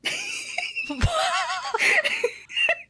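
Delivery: long, high-pitched burst of laughter; real squealing laugh; high laughing fit. Real, not acted. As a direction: crack up helplessly in high squeals. Laughing at the exchange.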